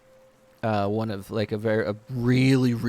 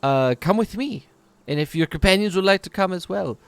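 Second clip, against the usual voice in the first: a touch higher, inviting elf; kind shopkeeper; 8 semitones higher, 6 dB louder than usual. Acted. Wood elf inviting adventurers along. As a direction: welcome them to the back. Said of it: "she just looks at you".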